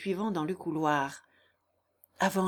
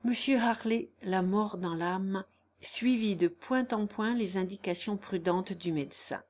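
ordinary delivery

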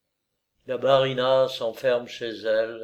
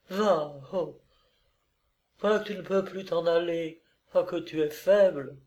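higher, lightly voiced woman in love; tender teasing woman; 6 semitones higher than usual. Olga to lover who cannot leave, tender.

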